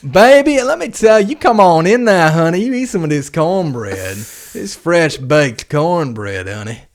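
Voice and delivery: high, loud Southern cook, settling as it goes; Southern cook persona. Acted. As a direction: coax a guest with a big high-pitched welcome. Hosting a Southern cook offering cornbread.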